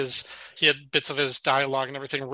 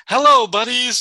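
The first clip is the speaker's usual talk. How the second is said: higher, louder booming hello to the gang; cheery greeting; 7 semitones higher, 9 dB louder than usual. Retold or imitated. Woody bursting in, greeting the bar crowd loudly. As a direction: call out a big hearty hello as you walk in.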